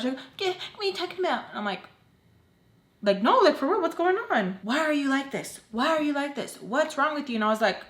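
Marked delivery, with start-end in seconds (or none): 0.4-1.4 imitating mom, much higher; mock the mom's high outburst; mom yelling. Mom confronting her at dawn, high mock yell. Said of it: "she starts screaming at me". 3.2-7.4 much higher, bewildered; confused protest. Retold or imitated. Just woken by mom, high confused protest.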